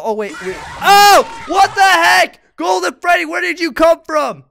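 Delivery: high loud panicked shouting; scared yelling. Real, not acted. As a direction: scream in fright at Golden Freddy. Jump scares in horror game.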